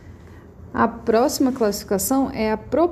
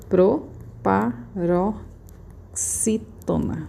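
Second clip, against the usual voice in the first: "proparoxítona" very slowly, pausing between syllables; 3 dB quieter, much slower than usual. Shown as a demonstration. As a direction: dictate "proparoxítona" in separate, drawn-out syllables. Soletra em sílabas enquanto escreve. ("proparoxítona" devagar, separando as sílabas)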